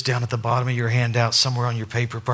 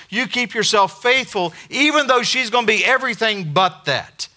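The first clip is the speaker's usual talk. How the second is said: higher, louder, brisk and cheerful; God voiced; 9 semitones higher, 5 dB louder than usual. God instructing Hosea, cheerful higher voice. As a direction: rattle off the plan brightly. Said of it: "And God goes".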